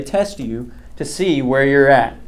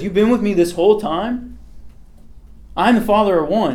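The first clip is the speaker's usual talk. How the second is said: higher, pressing voice of a disbelieving teacher; Jesus in disbelief; 7 semitones higher than usual. Acted. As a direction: push it like a teacher stunned by his student. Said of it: "he literally says".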